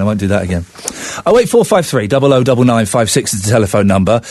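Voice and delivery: ordinary delivery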